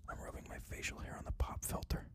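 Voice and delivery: unvoiced close-mic ASMR whisper. Shown as a demonstration. Podcaster demoing ASMR for his Patreon, whispering. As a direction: whisper right into the mic, teasing. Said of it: "Let me turn my mic".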